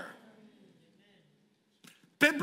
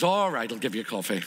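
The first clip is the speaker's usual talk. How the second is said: grudging; 9 semitones lower than usual. Acted. Manager gives in.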